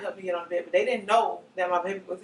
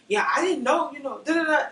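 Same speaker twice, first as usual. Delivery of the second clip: mocking; 8 semitones higher, 8 dB louder than usual. Mocking staff excuses.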